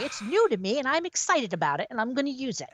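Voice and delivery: high, eager defensive voice; hammed-up excited mom; mock excited mom. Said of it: "I'm like".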